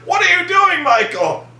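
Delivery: high yell falling on the name; mock bewildered scolding; exasperated director. Director scolding actor mid-take, exasperated yell.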